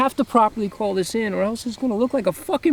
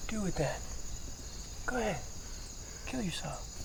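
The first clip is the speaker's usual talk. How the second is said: flat, much softer, cruel dare; quiet contempt after the shouting; cold and quiet; 17 dB quieter than usual. Acted. Cold reply in a quarrel.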